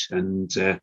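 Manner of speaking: ordinary talk, nothing marked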